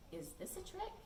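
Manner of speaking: high, soft wary ask; suspicious question. Acted. Lewis wary of Gilbert's eraser, soft high suspicion. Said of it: "Lewis looked at the eraser and said".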